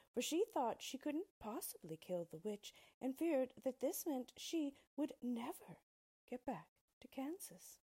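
usual speaking voice

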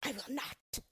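hissing witch's refusal; hissed. A narrator's reading. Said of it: "hissed the witch".

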